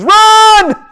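sudden high, loud shout of alarm; explosive, drawn-out RUN; urgent warning yell. Acted. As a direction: yell it like a friend warning of danger. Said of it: "If your friend looks at you and says".

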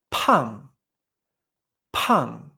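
"pan" as p'ang, puffed p and sharply falling pitch; aspirated fourth tone. Shown as a demonstration. Teacher modelling P A N G for learners, aspirated falling demonstration. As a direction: say "pan" with a burst of air, ng ending, falling. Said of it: "es P A N G, en cuarto tono".

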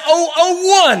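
high shouted oh oh; hammed-up amazement; mock excited gasp. Mock exclamation at plate.

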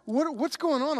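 mildly distressed questioning, halting and restarting; imitated anxious struggle. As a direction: act out a nervous, confused prayer. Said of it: "I think he's struggling. I think he's nervous. I think he's scared".

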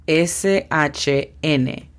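D E S K T A P spelled out slowly, letter by letter. Shown as a demonstration. (deletreo lento, letra por letra)

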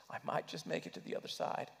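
fast, breathy whispered hope. Acted. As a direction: whisper it quickly like a secret. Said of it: "repeated as a whisper".

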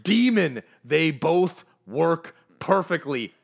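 high, loud, drawn-out hype; over-excited sales pitch. Acted. Pitching product placement to a co-host, wildly hyped. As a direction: pitch it loud and high, stretching each word.

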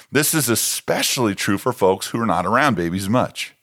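usual speaking voice

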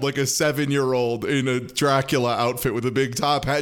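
usual speaking voice

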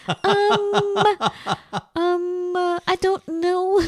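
put-on high, slow Valley girl accent, giggling through the ums; a lost Los Angeles Valley girl, giggly and stalling. Mock Valley girl accent while baffled by the clerk. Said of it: "that kind of Los Angeles Valley girl".

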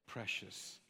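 hushed, whispered tease; mock preciousness. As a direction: whisper it as a mocking little jab. Mock self-check, teasing the fragile.

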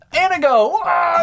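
high, loud excited yelp; excited shout. Pretend. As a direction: blurt out the town like a discovery. Shouting a random town pick.

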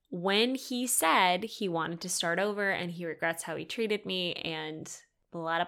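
ordinary talk, nothing marked

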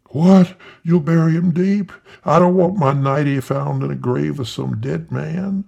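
high, loud startled cry; startled old wife. Acted. Old wife protesting to her husband about her nightie. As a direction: shriek the what, then fret about the nightie.

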